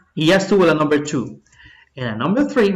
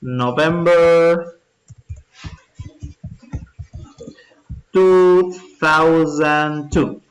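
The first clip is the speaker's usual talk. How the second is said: slow and a bit louder, drawn out; slow dictation; 2 semitones higher, 3 dB louder, much slower than usual. Shown as a demonstration. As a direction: read the date out slowly for copying. (dictado lento y marcado)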